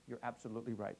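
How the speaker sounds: ordinary talk, nothing marked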